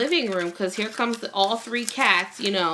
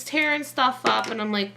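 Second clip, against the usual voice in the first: usual speaking voice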